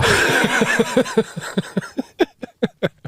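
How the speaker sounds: long breathy high laugh; giggling wheeze; voiceless wheezing laugh. Real, not acted. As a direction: wheeze at your own punchline. Laughs at own punchline.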